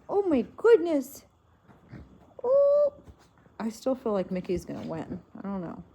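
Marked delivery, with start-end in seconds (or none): 0.1-2.9 rising, loud alarmed oh my goodness; startled squeal climbing high; surprised exclaim. Real, not acted. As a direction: yelp as the fight gets rough. Pets fighting rough.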